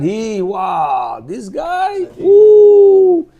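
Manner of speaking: high, drawn-out awe; mock admiration. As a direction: gush like an impressed onlooker, ending on a high "ooh". Outsiders gushing over the admired man.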